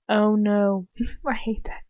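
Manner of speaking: usual speaking voice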